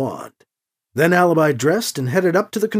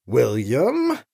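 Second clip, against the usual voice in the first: lower, questioning warning on the name; understated displeasure, name left hanging upward; displeased; 4 semitones lower than usual. A narrator's reading. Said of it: "communicate volumes of displeasure".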